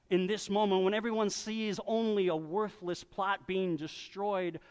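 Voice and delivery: usual speaking voice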